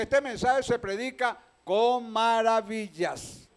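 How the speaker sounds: slow, weighty quoting of the prophet; slow quoted prophet. Retold or imitated. Pastor quoting the prophet to the church, slow and weighty. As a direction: lay each word down slowly. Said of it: "el profeta dice".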